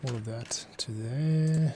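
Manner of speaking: ordinary talk, nothing marked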